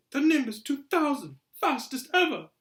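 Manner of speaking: high, loud marvelling; awestruck boy. A narrator's reading. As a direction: gush over the most coveted toy in the window. Awed boy admires new broomstick.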